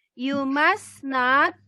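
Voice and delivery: slow teacher demo of you must not; slow dictation pace; slow model reading. Shown as a demonstration. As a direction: read it out slowly for pupils to copy.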